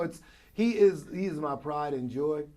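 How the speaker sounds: ordinary delivery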